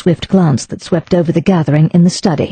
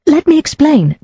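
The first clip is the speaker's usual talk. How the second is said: begging; 10 semitones higher than usual. Acted. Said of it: "Daisy begged".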